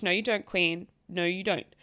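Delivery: ordinary talk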